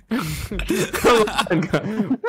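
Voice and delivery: laughing. Real, not acted. Laughing at the joke.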